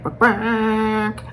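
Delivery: imitating chicken bark. Chicken mom imitating her irritated hens, mock bark. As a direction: bark like a grumpy hen. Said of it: "they do this".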